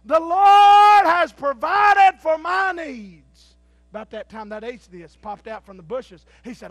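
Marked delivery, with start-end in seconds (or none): 0.1-3.6 high, loud, very slow thanks; shouted praise. Acted. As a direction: drawl out grateful praise. Lady finds the food box.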